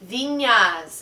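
Viñas with Spanish ñ as nya; Spanish pronunciation. Shown as a demonstration. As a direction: say Viñas the Spanish way, vee-nyas. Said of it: "Wait, wait".

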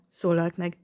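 usual speaking voice

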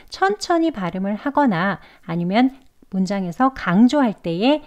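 ordinary delivery

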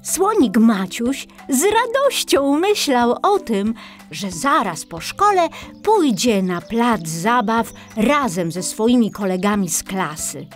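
plain reading voice

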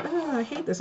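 mock complaint. Coworkers grumbling about the yellow tape, mock complaint. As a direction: whine it lightly, imitating someone fed up.

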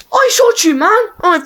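screamed very high and loud; outraged shriek. Real, not acted. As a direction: shriek in disbelief at the screen. Gamer outraged at missed kill.

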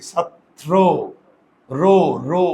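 ছাত্র as "chhat-tro", then the ending "ro, ro"; pronunciation demo. Shown as a demonstration. Teacher shows the jaw stays closed, ছাত্র ending in rounded "ro". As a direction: sound out ছাত্র with a closed o at the end. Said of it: "এটাকে উচ্চারণ করে কি?".

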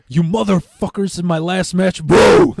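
hamming up a yell that swells to a loud high boo; mock yell. Friend retelling lighting the fire, loud, high mock yell.

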